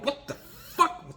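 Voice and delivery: very high, slow, halting squeak; drawn-out high-pitched mock dog bewilderment; bewildered dog's voice. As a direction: play a jostled dog squeaking in disbelief. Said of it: "my dog the whole time's going".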